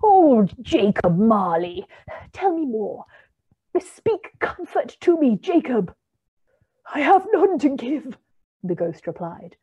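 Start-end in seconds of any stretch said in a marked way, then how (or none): 0.0-5.9 imploring. A narrator's reading. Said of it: "he said imploringly". 6.9-8.2 high, loud wailing spirit; Marley's ghost demanding belief from Scrooge; ghostly voice. A narrator's reading. The ghost speaks.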